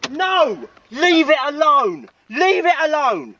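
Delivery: high, drawn-out angry protest; exasperated outburst. Real, not acted. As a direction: lose patience and order them off. Shocked again, loses patience.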